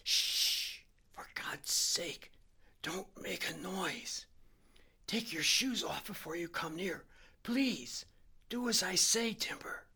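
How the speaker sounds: strained, high, slow whisper-pleading; hoarse half whisper. A narrator's reading. Sick Harry begs his friend to be silent, hoarse straining half whisper. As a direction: force out each word as if in pain. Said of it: "just the same hoarse, straining half whisper".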